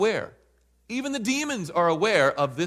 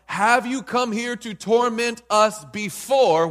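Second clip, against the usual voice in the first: loud cry; 5 semitones higher, 5 dB louder, slower than usual. Acted. Demons asking about the time.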